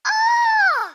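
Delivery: high, loud startled cry; the surprise breaks through, yelled; a real yelp of shock; shocked shout. Real, not acted. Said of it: "j'accentuerais pas ma voix".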